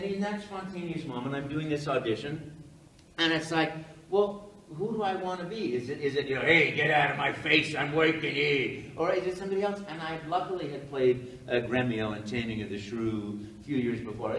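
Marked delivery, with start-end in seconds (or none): 6.4-8.8 put-on loud, rough Brooklyn accent; gruff Brooklyn plumber. Actor weighing who to be, loud gruff Brooklyn accent. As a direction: bark it like a busy Brooklyn plumber. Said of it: "a character that is gruff and rough".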